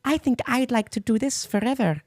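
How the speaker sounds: very high little Nordic princess voice; Emmy. Retold or imitated. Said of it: "Seeing this little Nordic princess talking".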